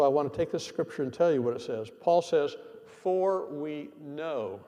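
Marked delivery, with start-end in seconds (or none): 2.9-4.6 "For we know" drawn out slowly, each word weighted; emphatic. Shown as a demonstration. Preacher stressing to the congregation, slow and emphatic "For we know". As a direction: lean hard on "For we know" and take your time. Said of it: "That's emphatic".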